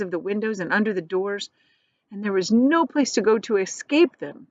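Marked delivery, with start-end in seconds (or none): none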